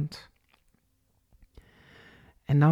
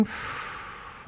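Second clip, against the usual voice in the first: an unvoiced puff of breath out; demonstrated exhale; 16 dB quieter than usual. Shown as a demonstration. Instructor showing the out-breath, an unvoiced puff. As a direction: blow the air out through the lips.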